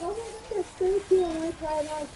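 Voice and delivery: high, cooing excitement; retold delight. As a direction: gush as if spotting something adorable. Retold delight at cute dress.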